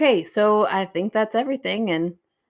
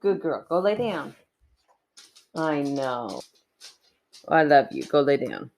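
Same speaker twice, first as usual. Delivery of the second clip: talking to the dog; 3 semitones lower, 3 dB quieter than usual. Real, not acted.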